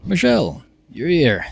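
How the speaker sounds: high-pitched, excited hello; excited greeting. Acted. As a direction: greet a friend with a delighted leap in pitch. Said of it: "reason you're excited to see me".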